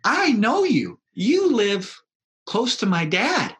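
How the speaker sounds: put-on four-year-old boy telling the pastor, high childlike voice; little boy. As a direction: sound like a proud small child. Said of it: "youngest one four or five".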